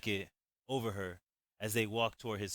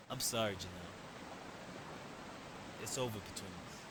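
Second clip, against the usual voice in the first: soft, sad farewell; quietly breaking it off with sadness; 10 dB quieter, faster than usual. Acted. Narrator telling Janelle goodbye, softly sad. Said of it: "with sadness in my voice".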